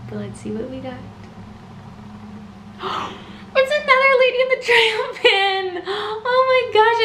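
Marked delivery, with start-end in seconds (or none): 3.1-5.8 shocked gasp then cry. Real, not acted. Reveal of wanted pin. 5.9-7.0 high, loud, slow teary gasp; overjoyed cry. Real, not acted. Collector crying out over her Lady and the Tramp pin.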